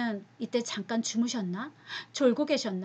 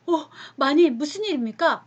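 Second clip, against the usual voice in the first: alarmed servants; 5 semitones higher, 7 dB louder than usual. Acted. Servants rush to the mistress.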